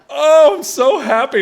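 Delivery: mock happy dad, cracking up; laughing dad quote. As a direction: squeal it out through laughter. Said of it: "And he was like".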